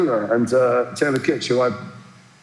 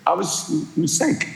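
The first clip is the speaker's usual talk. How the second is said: slow proud mumble; 4 semitones higher than usual. Retold or imitated. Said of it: "And he said".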